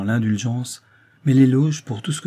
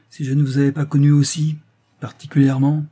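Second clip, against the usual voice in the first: a small pause, then «particulièrement» set apart, higher and louder; slight hesitation; 4 semitones higher, 6 dB louder than usual. A narrator's reading. Doctor alludes to their past, hesitating slightly before «particulièrement». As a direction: hold back briefly before saying «particulièrement». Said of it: "en hésitant un peu sur ce mot".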